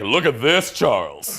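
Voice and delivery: a hint of a snooty rich-suburb accent; mock posh accent. Rich Edina resident gawking at celebrities, mild snooty accent. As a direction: lightly play a snooty rich local. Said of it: "an accent like that".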